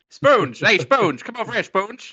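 shouting across a bar; calling out. Pretend. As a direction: call him over loudly. Calling gang member across bar.

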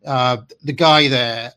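usual speaking voice

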